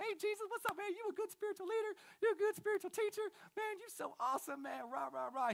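high, rushed mock fanboy gushing; hammed-up high-voiced bro flattery; schmoozing spiritual talk. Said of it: "make spiritual talk".

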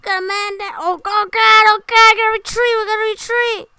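high panicked yelling; man down shout. Real, not acted. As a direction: scream the retreat order. Teammate down, retreating.

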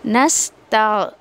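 नस ता very slow, whistled sibilant; slow tajweed demo. Shown as a demonstration. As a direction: carefully sound नस ता with a clear whistling s. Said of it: "सफ़ीर करते हुए नस ऐसे करना है".

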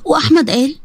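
ordinary delivery, nothing marked

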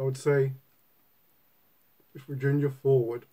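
talking around pipe stem; speaking while smoking pipe; pipe in mouth. Real, not acted. Seen 3: Pipe stem held in mouth while speaking until about 2.1, then lowered.

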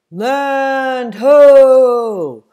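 long, high, loud shout across the ship; lookout's call. Acted. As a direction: call it out from the mast. Lookout calls land sighting.

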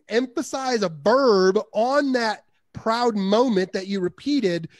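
usual speaking voice

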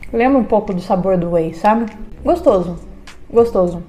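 tasting food while speaking; chewing while talking. Real, not acted. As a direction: mouth partly full of sweet. Seen 0: Holds bitten sweet ball, mouth working as if chewing while talking.

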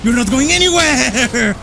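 excited yell. Real, not acted. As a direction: shout it down at the boss. Taunting a boss.

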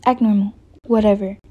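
normal talking voice

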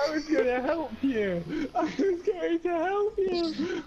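hammed-up wail of betrayal; mock crying. Mock betrayal after being passed.

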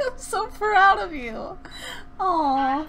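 high, slow, gushing pride; acted proud parent. Practicing acting, gushes pride at the Sim. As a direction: overact the proud parent, stretching every word. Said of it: "trying to practice my acting".

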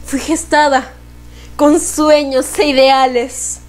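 measured, solemn recital; poem recitation. Acted.

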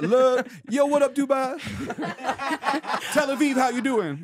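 put-on hype-man Middle Eastern accent, high. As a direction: shout it like a club host with a Middle Eastern accent. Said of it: "an offensive Middle Eastern accent".

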